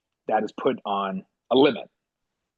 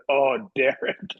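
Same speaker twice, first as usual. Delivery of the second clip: slow mock sympathy; much slower than usual. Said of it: "and you just said".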